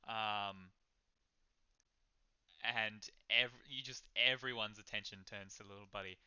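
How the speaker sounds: ordinary talk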